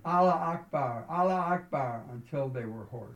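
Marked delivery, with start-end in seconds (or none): 0.0-2.0 higher, louder chanted cry; raised-voice acclamation, read by a narrator; narrated crowd shout. A narrator's reading. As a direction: shout it like a room full of believers. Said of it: "everyone in the room shouted".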